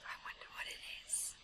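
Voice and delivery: fast, faint whisper aside; hushed whisper. Real, not acted. Reader wondering aloud to the child, faint whisper. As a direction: whisper it with curiosity.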